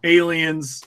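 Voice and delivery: ordinary delivery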